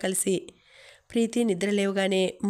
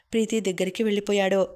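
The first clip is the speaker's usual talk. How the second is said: usual speaking voice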